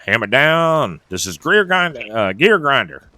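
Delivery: key the CB mic like a good ol' Southern trucker, hammed up; Southern trucker accent. New driver on the CB radio, mock Southern trucker accent. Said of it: "they get a Southern accent".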